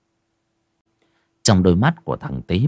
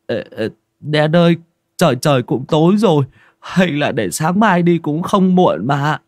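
hesitant; 7 semitones higher, 3 dB louder than usual. Acted. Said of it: "Tý chần chừ".

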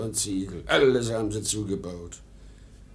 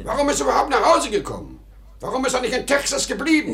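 fast, high, exasperated demands; angry questions; 12 semitones higher, much faster than usual. Acted. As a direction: fire off the questions in a rage. Angry father rants about son.

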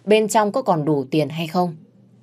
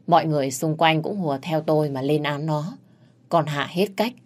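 ordinary delivery, nothing marked

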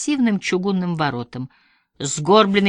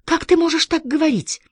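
hurt tearful plea; 11 semitones higher, 3 dB louder than usual. A narrator's reading. Tearful daughter protesting.

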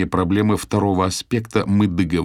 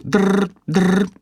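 phone ring; 13 semitones higher, 5 dB louder than usual. Retold or imitated.

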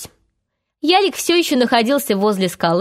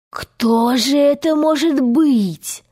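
slow, higher-pitched mock wonder; feigned amazement; 4 semitones higher, slower than usual. A narrator's reading. Hook slyly plays along, feigned amazement. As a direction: draw out the question in pretended astonishment. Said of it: "с притворным изумлением".

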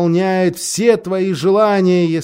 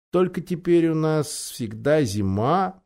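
sad; 5 dB quieter than usual. A narrator's reading. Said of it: "печально добавил он".

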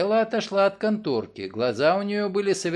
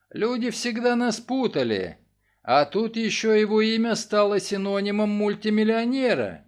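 ordinary delivery, nothing marked

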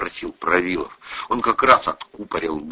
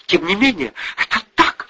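curt, higher-pitched daughter; cutting retort; 11 semitones higher than usual. A narrator's reading. Daughter answers mother, cutting her short. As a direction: cut mother off firmly. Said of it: "отрезала дочь".

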